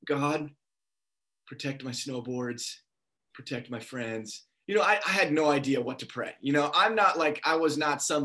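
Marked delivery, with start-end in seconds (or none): 0.0-4.5 hushed, low, halting prayer; small, subdued imitated prayer; retold prayer. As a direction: pray softly, pausing between requests. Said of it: "this is literally what I prayed".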